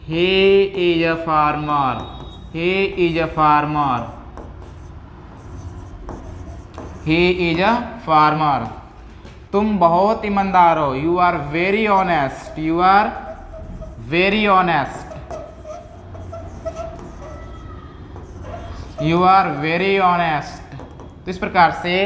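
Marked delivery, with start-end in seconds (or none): 0.1-8.7 He is a farmer said very slowly, repeated; slow and clear for learners; very slow drill. Shown as a demonstration. Teacher repeating the sentence very slowly for students. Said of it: "teacher drills slowly". 11.0-20.8 You are very honest said very slowly in pieces; slow drill. Shown as a demonstration. Teacher slowly drilling students on the sentence. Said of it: "teacher drills slowly".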